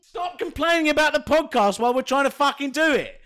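mock angry shout. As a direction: shout him down, half joking. Shouting at cohost.

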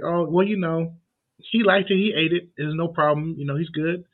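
a casual dad voice, playing it down; imitating a dad brushing it off.

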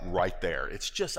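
normal talking voice

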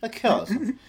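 southern drawl, sung out high; southern accent. Pretend. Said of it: "I'm doing a southern accent".

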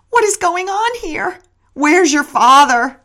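angry shouting. A narrator's reading. Mother shouting after the accident.

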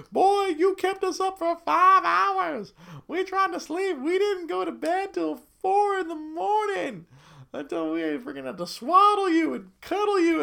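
tired dad scolding his newborn son for keeping them up; mock scolding dad. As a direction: scold the baby in jest. Dad scolds baby in note.

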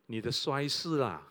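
usual speaking voice

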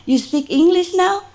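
high, amazed question; delighted surprise. Retold or imitated. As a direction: act astonished that the dog talks. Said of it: "I said".

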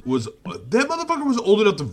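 high, fast, outraged yell. Real, not acted. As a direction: yell it in disbelief. Heated rant about Anakin's age.

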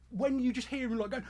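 normal talking voice

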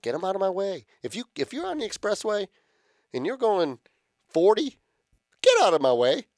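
heated rant, pitched high; fired up. Real, not acted. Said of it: "I'm fired up about it".

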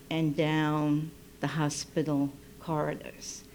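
usual speaking voice

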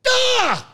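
mock yelp of disgust; high cartoon scream. [name] yelps.

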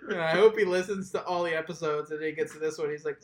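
usual speaking voice

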